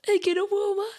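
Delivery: a high, whiny retold wail of despair; mock hysterical crying. Host retelling her stressed breakdown to listeners, mock crying wail. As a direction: whimper it like she's at the end of her rope, half-laughing. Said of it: "me puse a llorar histérica y yo decía".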